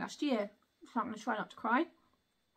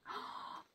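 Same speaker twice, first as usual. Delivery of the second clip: a tiny unvoiced breath of wonder; soft gasp; 16 dB quieter than usual. Real, not acted. Opening the wand for viewers, a hushed gasp. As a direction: gasp quietly as the box opens.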